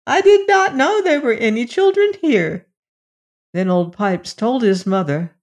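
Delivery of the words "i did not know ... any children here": put-on high-pitched old woman, protesting; high, surprised old-woman voice; old woman exclaiming. A narrator's reading. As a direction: insist in a high old mother's voice. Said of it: "exclaimed the old woman".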